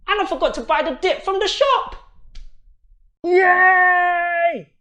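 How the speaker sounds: high, loud, fast delighted outburst; excited. Acted. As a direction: gush with delight. Excited after reading dip.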